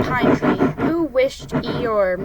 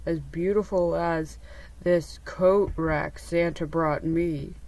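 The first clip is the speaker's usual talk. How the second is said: low, slow, softer donkey voice; Eeyore, wistful; 5 semitones lower, 5 dB quieter than usual. A narrator's reading. Donkey admiring his coat rack, low and wistful. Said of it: "Eeyore answered wistfully".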